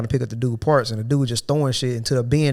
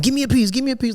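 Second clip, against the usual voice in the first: whiny begging voice; 12 semitones higher, faster than usual. Retold or imitated. Coworker begging for food.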